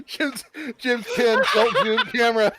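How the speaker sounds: high, loud, words lost in laughter; laughing. Real, not acted. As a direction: giggle through the words. Cracking up at camera.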